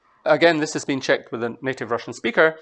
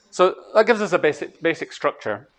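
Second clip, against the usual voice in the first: ordinary talk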